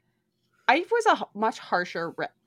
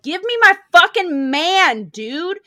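loud, higher furious demand; angry yelling; 7 semitones higher, 14 dB louder than usual. Retold or imitated. Woman yelling at officials for her man. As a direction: yell it at the people in charge. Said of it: "keeps going up to the people in charge".

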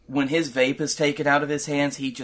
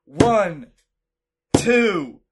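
referee count; 5 semitones higher, 5 dB louder than usual. Acted. Counting the pin.

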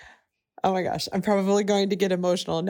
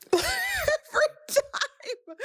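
high, slow, laughing through tears; tearful break on the words; crying laugh; much slower than usual. Real, not acted. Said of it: "I'm choking up every time".

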